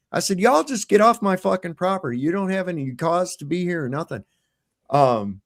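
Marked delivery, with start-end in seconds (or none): 0.4-4.2 high-pitched indignant telling-off; retold angry order. Father ordering police off property, retold angry order. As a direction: send them away, voice pitched up.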